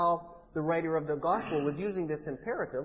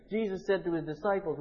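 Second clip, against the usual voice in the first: ordinary delivery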